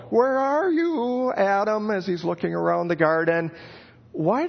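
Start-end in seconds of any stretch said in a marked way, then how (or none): 0.1-1.9 higher, slow, almost comical search call; God calling out. Acted. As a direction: call Adam like a parent playing hide and seek. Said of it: "it's almost comical".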